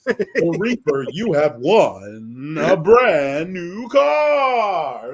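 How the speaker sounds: slow booming prize announcement; game show host. Acted. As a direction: draw out the big win, loud.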